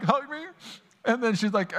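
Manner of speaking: ordinary delivery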